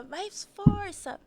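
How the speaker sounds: high, slow, drawn-out urging; mock coach chanting push; pushy midwife. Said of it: "ela era muito assim".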